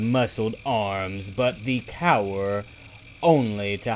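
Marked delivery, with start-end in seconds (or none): none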